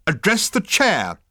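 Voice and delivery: high, loud, drawn-out order; snapping. A narrator's reading. Management consultant orders Ford, snapping. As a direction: snap it like a committee stickler. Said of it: "snapped the management consultant".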